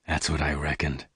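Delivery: low and soft, under the breath; quietly. A narrator's reading. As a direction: keep it hushed and knowing. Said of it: "Lassiter said quietly".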